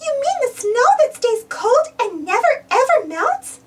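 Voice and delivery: a hint of Minnie asking eagerly; Minnie's voice. A narrator's reading. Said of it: "asks Minnie".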